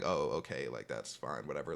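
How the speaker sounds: low, soft retold shrug; downplay it under your voice; brushing it off. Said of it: "I try to laugh it off".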